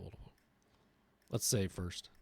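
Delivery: ordinary talk, nothing marked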